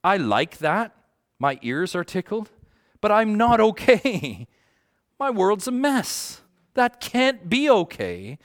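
pleading with himself, voice climbing high; retold inner protest. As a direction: raw retold despair, pitched high. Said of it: "I walked away saying".

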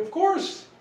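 mock obvious reply. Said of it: "And we're like".

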